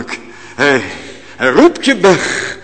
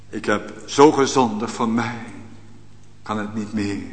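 a hushed, lower voice of a man who has given up; despairing sinner; 4 semitones lower, 8 dB quieter than usual. Retold or imitated. Preacher voicing a despairing sinner, hushed and low. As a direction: let it sink low and quiet, hopeless.

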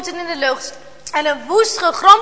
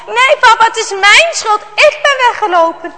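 insistent; 6 semitones higher, 5 dB louder than usual. Acted. Boy protests, blames himself.

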